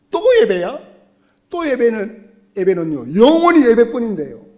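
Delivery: loud whining protest; exasperated complaint. Pretend. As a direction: groan it like a bored churchgoer. Voicing a worshipper weary of worship. (짜증 섞인 불평)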